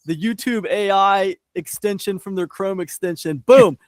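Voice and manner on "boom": loud exclamation. Pretend. Mimicking instant AI summary.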